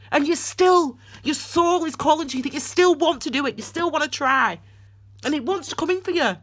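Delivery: urgent, emphatic tearful urging; impassioned outburst. Real, not acted. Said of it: "that fluffing outburst".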